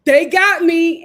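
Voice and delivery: hammed-up smitten gush; giddy mock swoon. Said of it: "K C is sitting there like".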